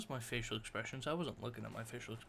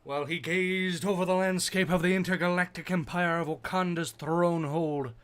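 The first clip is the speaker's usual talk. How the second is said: raised, loud epic narrator voice; 7 semitones higher, 15 dB louder than usual. Acted. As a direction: boom it out like a heroic king.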